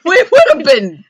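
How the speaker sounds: high, slow, laughing and squealing; laughing hard. Real, not acted. Laughing at the scary thought.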